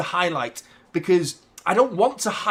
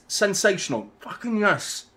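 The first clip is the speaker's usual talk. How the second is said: ordinary talk, nothing marked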